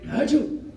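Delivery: a high, pretend sneeze; imitated sneeze. Shown as a demonstration. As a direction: act out a sneeze. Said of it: "you say".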